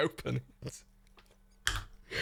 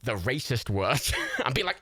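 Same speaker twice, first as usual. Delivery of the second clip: normal talking voice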